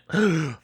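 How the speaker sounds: sharp surprised gasp; shocked gasp. Real, not acted. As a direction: gasp at the news. Reaction to pregnancy news.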